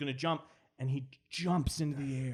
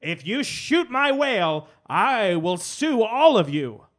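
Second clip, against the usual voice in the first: louder and higher, slow menacing; loud slow threat; 8 semitones higher, 9 dB louder, slower than usual. Acted. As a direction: threaten the soldiers slowly. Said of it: "she's like".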